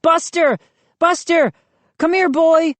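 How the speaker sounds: high-pitched calling to a dog; shouting. A narrator's reading. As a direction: call the dog from afar, high. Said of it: "started shouting up to the window".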